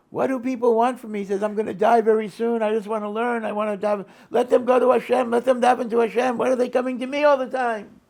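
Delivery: put-on an old, nearly deaf rabbi bellowing his complaint; yelling. A deaf rabbi shouting at the visitor about the notes, loud exasperated yelling. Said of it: "And he's yelling".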